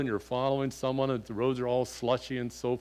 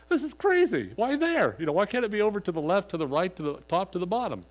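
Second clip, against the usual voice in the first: much higher, louder, fast exasperated rant; frustrated outburst; 7 semitones higher, 4 dB louder, faster than usual. Retold or imitated. Driver fuming to himself over smeared windshield, exasperated. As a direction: throw up your hands at the smeared glass.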